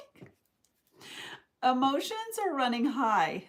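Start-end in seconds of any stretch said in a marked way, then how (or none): 1.6-3.4 higher, louder, through laughter; laughing. Real, not acted. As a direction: laugh your way through the admission. Laughs at own crying confession.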